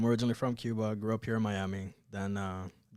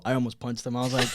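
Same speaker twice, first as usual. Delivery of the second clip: ordinary talk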